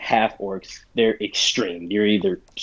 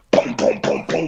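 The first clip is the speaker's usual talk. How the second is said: rapid voiceless booms; mouth-made crashing thuds; imitated banging; 6 semitones higher than usual. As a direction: puff out a run of bangs. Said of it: "you hear multiple banging".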